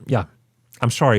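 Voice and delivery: normal talking voice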